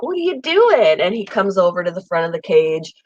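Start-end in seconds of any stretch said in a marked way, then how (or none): 0.0-1.0 high sing-song voice for the bird; high pet talk. Retold or imitated. Owner cooing at her parrot in a high sing-song. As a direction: coo it up high like talking to a pet. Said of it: "But I'll be like".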